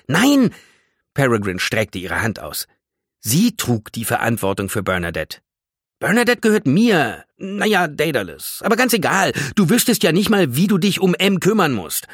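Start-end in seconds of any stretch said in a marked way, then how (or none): 0.1-0.5 high, loud refusal; alarmed protest. Acted. Objects to losing the plant. 6.0-12.1 high, loud, possessive argument; indignant protest. Acted. Acted quarrel over the plant.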